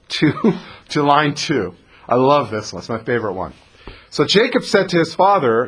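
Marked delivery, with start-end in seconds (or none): none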